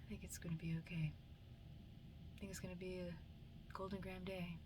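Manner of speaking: very soft, tentative self-reassurance; soft hopeful murmur. Acted. To oneself as sunlight peeks through clouds, softly hopeful.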